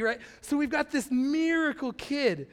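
normal talking voice

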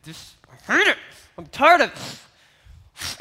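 hammed-up fed-up farmer moaning; mock frustration. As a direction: stretch out the farmer's griping. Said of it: "he's getting mad".

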